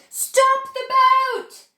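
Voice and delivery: big high shout, slowed right down; a booming order to stop. Acted. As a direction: bellow it to halt the boat. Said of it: "When Mister Wonka shouted".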